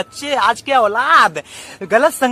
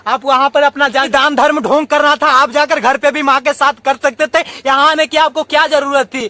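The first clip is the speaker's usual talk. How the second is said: louder, higher, scornful scolding; scolding son; 4 semitones higher, 4 dB louder than usual. Acted. Son berating his father for coming to the office, scornful. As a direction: tell the old man off with contempt.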